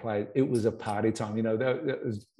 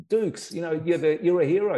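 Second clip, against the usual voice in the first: gushing excitedly; 6 semitones higher, 4 dB louder than usual. Retold or imitated. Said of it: "I kept on going".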